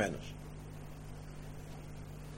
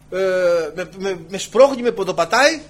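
ordinary delivery

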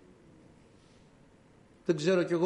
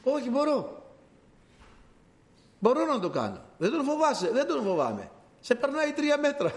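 put-on eager young David; 8 semitones higher than usual. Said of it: "Του λέει ο Σαούλ".

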